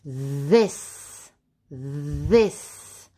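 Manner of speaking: THIS low, opening on a long buzzing th; voiced TH. Shown as a demonstration. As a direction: hum into THIS slowly for learners. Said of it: "This makes the the sound".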